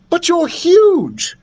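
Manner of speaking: high, slow, emphatic amazement; disbelieving princess voice; incredulous. A narrator's reading. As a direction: stretch it out in astonishment.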